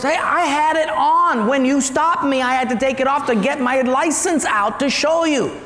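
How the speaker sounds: high, insistent protest; mock outraged excuse; indignant driver. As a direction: defend yourself hotly to the officer. Driver protests seatbelt fine.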